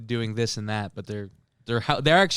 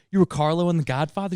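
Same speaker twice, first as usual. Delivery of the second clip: higher-pitched incredulous question; retold amazed question, pitched up; acted surprise; 7 semitones higher, 3 dB louder than usual. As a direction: play astonished recognition.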